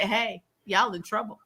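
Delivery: ordinary talk, nothing marked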